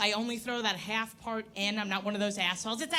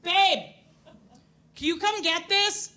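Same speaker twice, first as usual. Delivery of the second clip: calling out to babe; 6 semitones higher, 5 dB louder than usual. Retold or imitated. Calling partner from the shelf.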